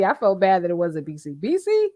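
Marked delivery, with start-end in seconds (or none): 1.3-1.9 hopeful question pitched much higher; imitating eager Nesto. Streamer re-imitating Nesto asking about the call, high hopeful. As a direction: squeak out hopefully like he's sure it's the lawyer. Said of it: "the way he said B C".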